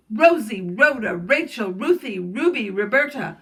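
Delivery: loud gorilla roll-call of names. A narrator's reading. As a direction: summon each one loudly, name by name. Gorilla calling the monkeys over.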